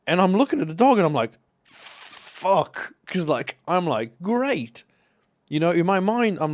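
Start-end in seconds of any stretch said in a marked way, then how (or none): 1.7-2.9 breathy unvoiced fuck under the breath; a sudden scared hiss of a curse; whispered panic curse. Rider sees the dog ahead, cursing in a whisper. 4.2-4.8 high, sarcastic great. Retold or imitated. Rider realising he will hit the dog, sarcastic and higher.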